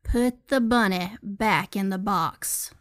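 put-on slow, drawn-out generic Southern accent; low, measured Southern movie-hero line. Acting out a movie scene after a countdown, slow Southern accent. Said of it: "you even slipped into the, like, the little, uh, Nolans accent".

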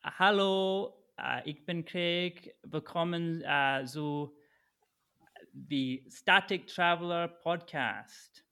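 podcast promo in German. Pretend. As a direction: sell the show like a slow radio promo. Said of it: "tell people to listen to the podcast in German".

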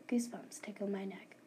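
hushed, eerie narration; whispered. A narrator's reading. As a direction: whisper it with a shiver.

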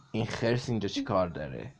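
ordinary delivery, nothing marked

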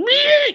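very high imitated insect squeal; sudden piercing little bug noise. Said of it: "bugs actually make a squeal like that".

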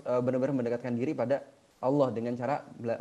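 ordinary talk, nothing marked

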